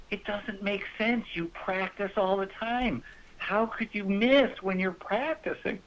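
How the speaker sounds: high-pitched impression of a puzzled wife; imitated wife. Wife questioning husband about his practice, high and puzzled. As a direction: raise the pitch well up, innocently baffled. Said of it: "She goes".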